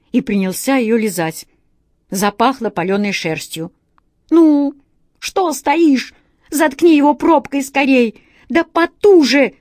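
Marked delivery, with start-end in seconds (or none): 4.3-9.5 loud, higher urgent shouting; hurried shout. A narrator's reading. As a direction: bark the order in a hurry. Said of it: "торопливо прикрикнул на Васю кот".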